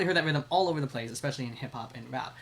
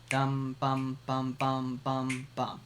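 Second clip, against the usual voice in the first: steady mouthed dum bum pattern; tresillo, slower; much slower than usual. Shown as a demonstration. Teacher sings the tresillo slowly, mouthed drum pattern. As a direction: sing the tresillo at an easy pace. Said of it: "Or quicker".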